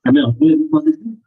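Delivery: slightly muffled, as through a hand; speaking with the mouth partly covered. Real, not acted. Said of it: "Yes, slightly muffled voice".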